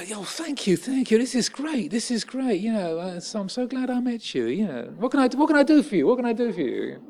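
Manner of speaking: the bloke's sudden eager, emotional gratitude; effusive gratitude. Retold or imitated. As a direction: gush like a man suddenly won over. Aggressor turns grateful, effusive.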